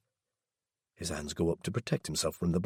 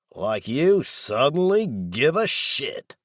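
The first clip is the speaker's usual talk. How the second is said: higher, louder, slow contemptuous retort; growling back; 6 semitones higher, 4 dB louder, much slower than usual. A narrator's reading. Daryl sneers back at Laz, contemptuous growl. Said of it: "he growls back".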